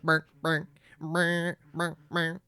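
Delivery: low buzzing burr like a ringing phone; phone ring imitation. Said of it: "it sounded like a phone".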